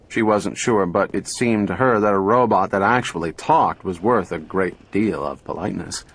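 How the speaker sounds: plain reading voice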